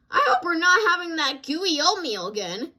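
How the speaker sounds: mock screaming cub complaint; screamed. A narrator's reading. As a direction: whine it loudly. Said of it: "screamed Mama".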